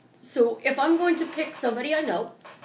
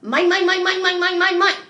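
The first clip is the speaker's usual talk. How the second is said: loud, high, rapid-fire greedy cartoon chant; Daffy Duck; 6 semitones higher, 8 dB louder than usual. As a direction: hammer out the repeated word like a possessive cartoon character. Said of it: "by Daffy Duck going".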